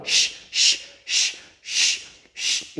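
long repeated "shh" blown out; voiceless hiss of shushing; shushing sound. Shown as a demonstration. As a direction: shush rhythmically for a child to copy. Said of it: "En este caso yo hago".

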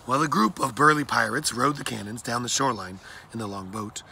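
plain reading voice

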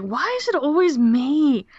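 whiny put-upon schoolboy voice; mock Neville whine, slow. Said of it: "he's just like".

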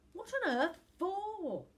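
loud, high, drawn-out incredulous question; exasperated disbelief. A narrator's reading. Boy scorns idea of going along.

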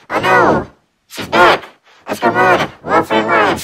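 high, loud mock alarm, calling out; play-acting a scared getaway at the top of the voice; pretend panic. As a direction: kid running from an imagined monster, shrieking in mock fear. Kid's pretend chase, fleeing.

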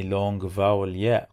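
ordinary talk

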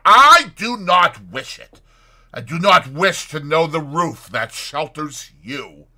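loud, high, emphatic refusal; forceful interruption. A narrator's reading. Fetz interrupts the doctor's offer, loud and vehement. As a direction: cut him off with vehement rejection. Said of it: "interrupted the other".

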